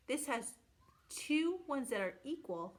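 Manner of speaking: usual speaking voice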